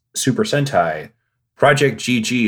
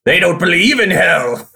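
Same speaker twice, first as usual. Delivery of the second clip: mock villain; 7 semitones higher, 5 dB louder than usual. Skit retort.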